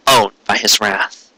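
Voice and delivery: plain reading voice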